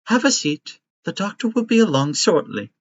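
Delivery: bright, high, sweet robot nurse; kindly robot caretaker. Acted. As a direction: play a doting robot nanny.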